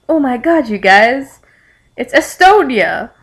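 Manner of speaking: loud, high-pitched excited announcement, playfully overdone; mock fangirl excitement. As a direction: gush it loud and high, stretching the country's name. Acted excited recognition of fan characters.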